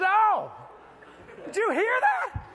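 high loud stunned yell; amazed cry. Retold or imitated. Drug dealer grabbing his chest in shock, high amazed yell. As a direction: shout it clutching your chest.

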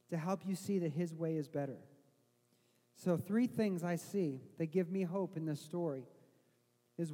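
usual speaking voice

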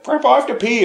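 put-on high little-boy plea; kid voice. Boy inside the box asking grandpa, high kid plea. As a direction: plead like a small child. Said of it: "he's like".

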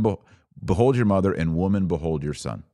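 usual speaking voice

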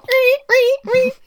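high, loud imitated shrieking strings; shrill repeated mock slasher sting; Psycho screech. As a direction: screech out stabbing horror-film stings. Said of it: "like she's in Psycho".

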